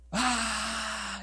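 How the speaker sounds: drawn-out, high, held-back mock scream; imitated scream. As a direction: imitate a boy's scream without full volume. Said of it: "gritaba como, como si lo estuvieran matando". (grito imitado, agudo y contenido)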